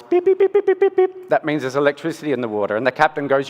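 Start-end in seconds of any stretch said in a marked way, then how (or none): 0.0-1.3 high, loud electronic beeps; imitated beeping. Speaker imitating the electro-fishing boat's beep, high and loud. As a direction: mimic the boat's warning beep. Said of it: "you hear this noise".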